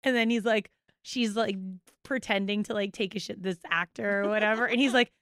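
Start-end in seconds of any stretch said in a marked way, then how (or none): none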